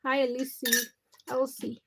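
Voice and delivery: ordinary talk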